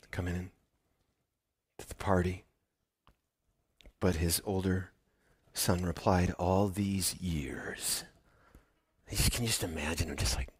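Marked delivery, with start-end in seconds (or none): 0.1-2.4 whisper. Acted. Father begging, whispered. 6.4-8.0 slow, bitter whisper; whispered resentment. Acted. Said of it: "just spitting these words".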